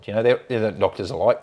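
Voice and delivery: ordinary talk, nothing marked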